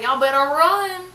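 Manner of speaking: loud high warning yell; mock yell. Teasing friends, shouts to run.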